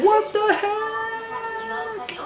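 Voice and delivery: very high, drawn-out disbelief; astonished. Real, not acted. Seeing a much bigger apartment, high amazed squeal.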